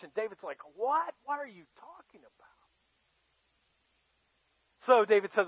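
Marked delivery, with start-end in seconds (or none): 0.8-2.5 high, soft, incredulous question; pitched-up "what", trailing into a hushed squeak; acted disbelief. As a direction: play a schemer caught off guard. David hears his plan failed.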